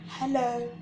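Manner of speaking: put-on reading to children. Reading a baby book aloud.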